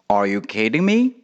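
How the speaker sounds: Are you kidding me with "me" rising at the end; rising question tune. Shown as a demonstration. Teacher models the line for the class, rising on "me". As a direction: let "me" climb in disbelief. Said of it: "他会说什么呢".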